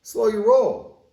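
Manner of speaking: a little atom holding on to its electron; squeaky "whoa, not so fast" cartoon protest; protesting magnesium. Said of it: "Magnesium's going".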